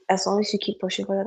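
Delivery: usual speaking voice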